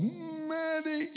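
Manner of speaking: sudden high, piercing yell; yelling for the medic. Retold or imitated. Wounded soldier in a helicopter yelling for the medic, piercing yell. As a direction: cry out for help in sharp pain. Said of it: "And I yelled".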